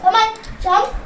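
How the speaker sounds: ordinary talk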